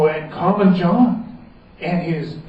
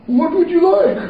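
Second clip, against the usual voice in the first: king voice; 13 semitones higher, 5 dB louder than usual. Acted. King speaks.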